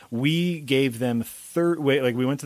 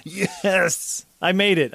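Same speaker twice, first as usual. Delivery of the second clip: excited cheer; 7 semitones higher, slower than usual. Pretend. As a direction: cheer like you just got cast. Celebrating a joke cast.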